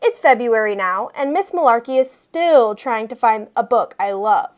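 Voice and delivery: ordinary delivery, nothing marked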